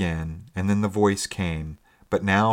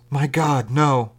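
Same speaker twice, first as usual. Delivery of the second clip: raised, forceful cry of refusal; extraordinary emphasis; 7 semitones higher, 5 dB louder, slower than usual. A narrator's reading. Stranger forbidding sailors to send a boat, extraordinary emphasis. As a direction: hit both phrases hard and high. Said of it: "said the voice with extraordinary emphasis".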